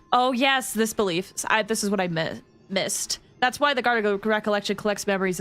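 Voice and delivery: usual speaking voice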